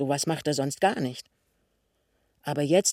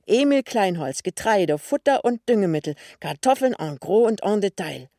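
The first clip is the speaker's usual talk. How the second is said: loud, higher, proclaimed list; excited sign reading; 4 semitones higher, 6 dB louder than usual. A narrator's reading. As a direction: read the shop sign out with excitement. Sie liest das Firmenschild vor. (begeistert laut vorgelesen)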